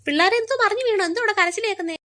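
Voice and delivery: put-on mother rushing in at the kids' cries; alarmed shout. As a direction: shout as you rush in at the crying. Mother rushes in at crying.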